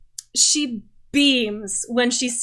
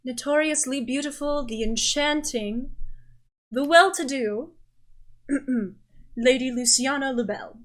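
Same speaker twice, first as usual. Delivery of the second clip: formal announcement. Acted. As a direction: announce her like a royal herald. Butler formally announces the lady.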